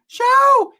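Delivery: very high, loud burst; yelled out the window. Retold or imitated. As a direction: holler one word at people outside. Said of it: "he'll yell out the window".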